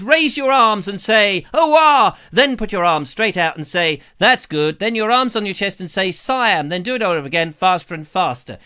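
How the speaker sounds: loud drill-like read-out; bellowed instructions. Acted. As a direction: shout the exercise orders to the room. Bellows out a viewer's exercise instructions.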